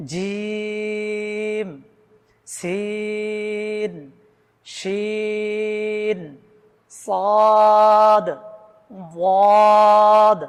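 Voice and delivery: জিম, সিন, শিন, সদ, ওয়াদ each stretched long; drawn-out letter names. Shown as a demonstration. Teacher showing learners how long to hold each letter. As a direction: pull every letter long, the last two loud. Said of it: "এভাবে লম্বা করে টেনে".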